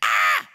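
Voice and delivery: sudden loud low yelp of alarm; hammed-up captain's yelp; panicked cry. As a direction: blurt out a scared ah. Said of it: "the ship's captain's going".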